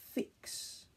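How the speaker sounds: "Fix" whispered, sounds pulled apart. Shown as a demonstration. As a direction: break "Fix" into its sounds, softly. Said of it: "My turn".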